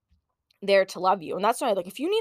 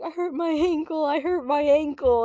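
high, mock-wailing kid; imitating a kid in pain; hurt child; 6 semitones higher, 3 dB louder than usual. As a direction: whine it out like an injured child. Hurt child crying to parents.

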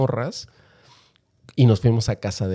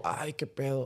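hushed alarm; 16 dB quieter than usual. Retold or imitated. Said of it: "empiezas como".

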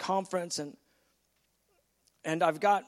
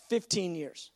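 normal talking voice

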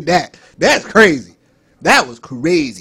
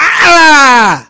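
loud high fake scream; 9 semitones higher, 12 dB louder than usual. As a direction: scream like a bratty kid. Kid fake screaming scene.

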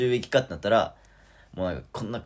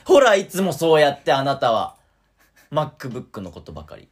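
nagging partner, louder at first; jealous wife; 6 semitones higher, 10 dB louder than usual. Pretend. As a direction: scold him like a neglected wife. Mock jealous partner quarrel. (拗ねた妻の口調で)

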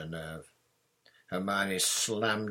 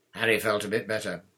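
usual speaking voice

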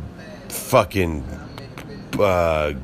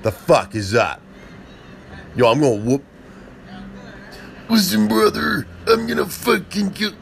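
high, cartoonish wrestler hype voice; mock wrestler promo, higher and higher; juiced wrestler promo; 9 semitones higher than usual. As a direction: ham up a roided wrestler cutting a promo. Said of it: "be like".

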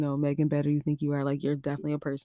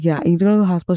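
urgent; 3 semitones higher, 11 dB louder, much faster than usual. Retold or imitated. Poison control repeats urgently.

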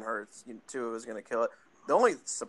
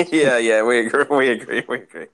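laughing; 13 dB louder than usual. Real, not acted. Laughing after teasing about impression.

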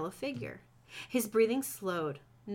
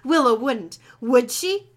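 loud, higher, horrified questioning; shocked disbelief; 6 semitones higher, 10 dB louder than usual. Acted. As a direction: cry out in alarmed disbelief. Mark shocked at overheard plan.

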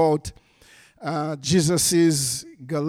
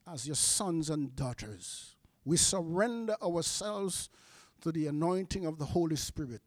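soft, lowered praying voice; hushed public prayer, falling away to the amen; 3 semitones lower, 9 dB quieter than usual. Real, not acted. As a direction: address God quietly and reverently. Leads a prayer.

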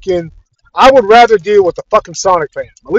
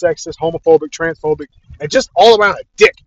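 normal talking voice

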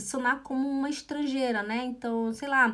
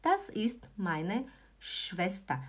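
slow, softer word-by-word delivery; slow model sentence; 2 semitones lower, 6 dB quieter, slower than usual. Shown as a demonstration. Teacher inviting learners to repeat, slow and soft. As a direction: say it gently for repeating. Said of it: "Repete junto comigo".

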